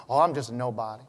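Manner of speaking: low, soft, mopey put-down of self; mock mopey humility. Pastor mimics a churchgoer calling himself nobody, soft and mopey. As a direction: mumble it like someone who thinks they're nobody. Mocking a falsely humble churchgoer.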